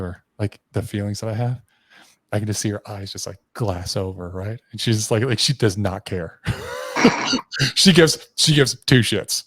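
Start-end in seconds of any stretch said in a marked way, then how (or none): 6.4-7.7 loud burst of laughter; real, wordless laugh. Real, not acted. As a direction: laugh out loud at your own story. Laughs at own story.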